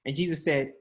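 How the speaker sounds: ordinary talk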